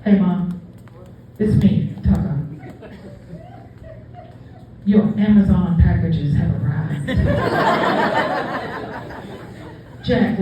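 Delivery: a fed-up dog with a headache; grumpy dog voice. Retold or imitated. Tucker's second message.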